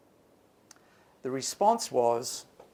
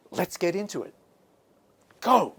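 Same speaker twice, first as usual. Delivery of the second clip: energetic go; 4 semitones higher than usual. Shown as a demonstration. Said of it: "It's a way of saying".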